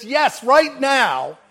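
high, loud, drawn-out "right now"; emphatic preacher's cry; urgent command of Jesus. Acted. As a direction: proclaim it slowly as an urgent order. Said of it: "Jesus says".

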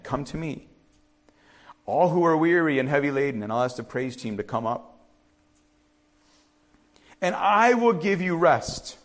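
measured Bible reading; scripture reading. Acted. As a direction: read the verse gently to the church. Reads scripture aloud.

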